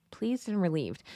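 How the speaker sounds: hushed, thoughtful "Pleased and relieved."; quiet repeat. Shown as a demonstration. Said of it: "They were thankful that the war was finally over".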